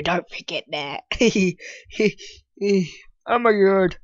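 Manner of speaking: ordinary delivery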